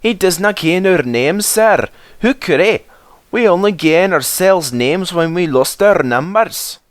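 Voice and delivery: high Scottish engine voices; Scottish twins. A narrator's reading. Twins answer the Fat Controller in a Scottish brogue.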